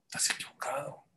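quiet unvoiced reproach; God rebuking Abraham in a whisper; whispered rebuke. God's final rebuke in parable.

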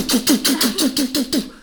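high rapid "tu tu tu" keystrokes; imitated typewriter pounding. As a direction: hammer out high, staccato typing noises. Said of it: "A bylo to takové". (vysoké napodobené bušení do psacího stroje)

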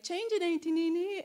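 high-pitched imitation of a small boy; little nephew. Nephew handing his aunt a toy Transformer, high childlike imitation. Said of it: "my little nephew bringing me a Transformer".

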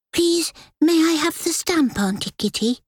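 put-on high eager boy's voice; pleading child. A narrator's reading. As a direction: beg eagerly like a little boy. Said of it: "pleaded urgently".

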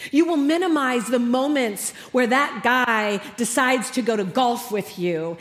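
normal talking voice

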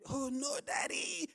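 put-on high, loud screaming child protest; retold scream. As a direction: shriek it like an upset daughter. Daughter's outburst retold, screamed.

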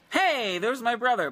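high, delighted hello; bright-pitched surprised welcome; real excited greeting. Real, not acted. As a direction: call out happily on spotting family. Greets brother joining the live chat.

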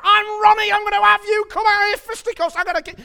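hammed-up northern accent; Ronnie Pickering northern accent. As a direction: rant like a northern hothead in a road rage. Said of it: "He's going".

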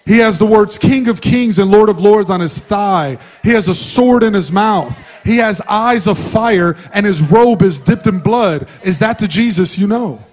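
loud fervent preaching over music; preaching at full force. Acted. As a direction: proclaim it to the church.

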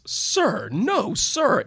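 loud, high booming "Sir, no, sir". Retold or imitated. Trooper answers the public defender's question, booming loud. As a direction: boom it out like a trooper on the stand. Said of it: "he said in a booming voice".

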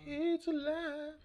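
very high, drawn-out "alive"; mock mad-scientist cry. Joking about dead things coming back, hammed-up mad-scientist cry. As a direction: stretch it into a thin, high monster-movie cry.

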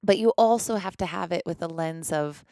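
usual speaking voice

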